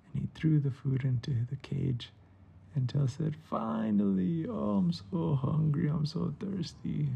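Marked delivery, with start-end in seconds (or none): 3.4-7.1 high, drawn-out dramatic relief; slow, high "finally", then hungry complaining; relieved starving gecko. Acted. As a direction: play a hungry pet finally getting fed. Starving gecko finally gets food.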